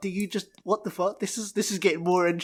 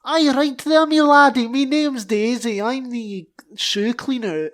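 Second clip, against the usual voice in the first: high, loud put-on accent; eager servant character; 6 semitones higher, 6 dB louder than usual. Cleaner introducing herself to the officer, high loud voice. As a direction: pipe up proudly, high and loud.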